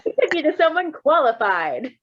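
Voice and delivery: sarcastic mock retort. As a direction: play it with hammed-up obviousness. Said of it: "It's like".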